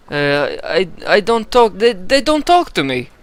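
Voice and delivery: frightened informant answering the steward; stammering informant. Acted. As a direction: stammer out the excuse.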